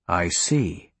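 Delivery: low, let-down acknowledgement; disappointed. A narrator's reading. Wizard hears princess gave up magic, disappointed. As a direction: drop the pitch in disappointment. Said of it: "the wizard said, disappointed".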